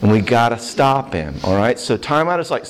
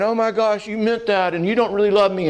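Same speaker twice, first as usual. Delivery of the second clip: put-on upset little girl; 8 semitones higher than usual. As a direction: whimper it like a wounded little kid. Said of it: "her inner little five-year-old person is like going".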